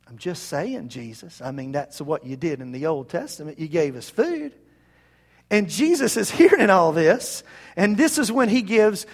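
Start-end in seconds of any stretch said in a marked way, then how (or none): 0.1-4.5 low, hushed, offhand aside; quiet, just-saying innuendo; sly nudging. Acted. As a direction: drop a sly hint under your voice. Mock crowd nudging Jesus.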